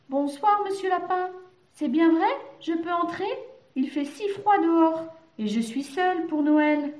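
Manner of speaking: high-pitched little mouse voice; hopeful mouse visitor. A narrator's reading. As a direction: ask eagerly in a high mouse voice.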